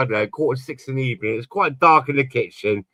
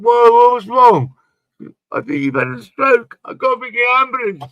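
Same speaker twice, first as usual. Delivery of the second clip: high mock panic; 10 semitones higher, 4 dB louder than usual. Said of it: "I said".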